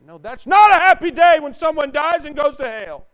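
loud, high shouted preaching; fiery preacher shout; full-force preaching. Acted. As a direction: hammer it home from the pulpit.

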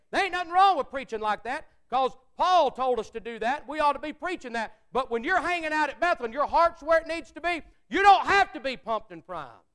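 loud, high fiery preaching; full-force preaching. Acted. Preacher urging his church, loud and high. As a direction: pastor pounding it home to the congregation, loud and fervent.

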